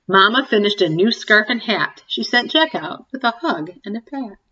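ordinary delivery

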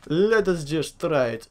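drawn-out, careful repeat; slow and deliberate. Real, not acted. Player about to try something, slow and deliberate. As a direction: stretch each word out deliberately.

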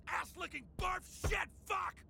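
shouted string of curses; exploding in frustration; furious swearing outburst. Acted. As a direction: burst out cursing, punching the last word. Swearing breaks through attempts at calm.

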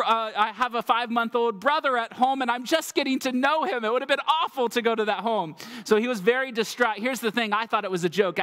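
normal talking voice

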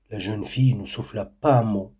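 ordinary delivery

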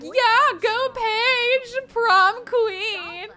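high, loud sarcastic cheer; mock her fake cheering; high snide cheer; passive-aggressive cheer. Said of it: "being passive when she's like".

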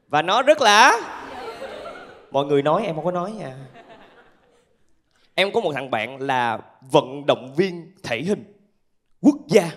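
usual speaking voice

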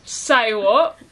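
loud Australian "Say what", with "Say" sounding like "sigh"; Australian accent. Shown as a demonstration. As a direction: put on a bold Australian accent. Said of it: "debating about this Australian accent".

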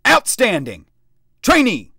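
loud, high sharp shouts; barked. A narrator's reading. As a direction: snap each word. Sergeant barks.

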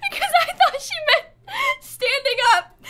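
high, squealing words through helpless laughter; cry laughing. Real, not acted. Telling viewers the graveyard story, laughing hard. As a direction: barely get it out for laughing.

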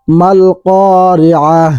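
"আল কারিআহ" ending in a stopped h, slow; pausal form. Shown as a demonstration. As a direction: recite "আল কারিআহ" stopping on the final h. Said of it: "ওয়াকফ করলে". ("আল কারিআহ" থেমে হ দিয়ে শেষ)

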